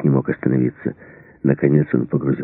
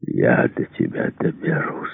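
slow, soft, husky menace; hoarse threat; 4 semitones lower, 6 dB quieter, slower than usual. A narrator's reading. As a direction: rasp out a slow promise of revenge. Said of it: "а потом хриплый голос, голос Рэмбо". (хрипло, медленно, с угрозой)

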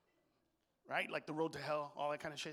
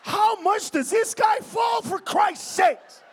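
ordinary talk

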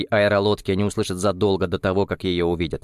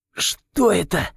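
slow, high, rasping croak; hoarse croak; 9 semitones higher, much slower than usual. Acted. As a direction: croak it out, dying in pain. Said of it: "прохрипел Иван".

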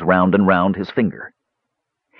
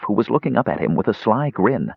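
usual speaking voice

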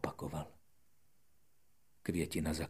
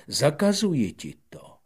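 loud, high commanding king; royal command; 8 semitones higher, 9 dB louder than usual. Acted. King forbidding the prince to yawn, loud and high. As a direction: forbid it with full royal authority. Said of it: "řekl mu mocnář".

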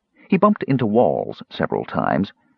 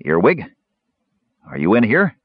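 calling out. A narrator's reading. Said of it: "the fighter called".

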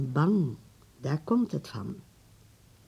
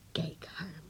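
soft whisper to get his attention; hushed aside; 11 dB quieter than usual. Acted. Grandmother confiding in Harm, whispered. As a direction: lean in and whisper.

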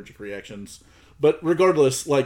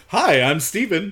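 high, loud self-introduction; mock podcast host; 7 semitones higher, 7 dB louder than usual. First Steven introducing himself to listeners, high mock-host voice. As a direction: greet listeners in a hammed-up higher host voice. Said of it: "I could just talk to myself".

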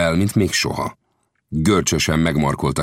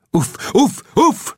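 much higher, louder frightened uffs; terrified cry; 3 dB louder, slower than usual. A narrator's reading. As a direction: cry out in sudden terror. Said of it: "kiáltott fel a törzsfőnök rémülten".

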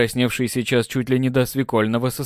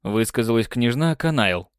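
usual speaking voice

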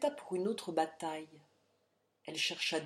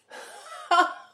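furious scornful laugh; 12 semitones higher, 9 dB louder than usual. Acted. Father bursts out in rage.

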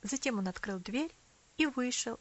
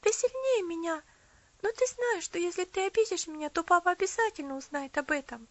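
put-on high, earnest girl; young girl voice; 8 semitones higher, 5 dB louder than usual. A narrator's reading. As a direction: warn him gently. Girl Elsie warning.

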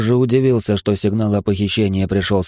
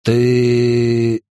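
drawn out. Acted. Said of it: "только и протянул адмирал".

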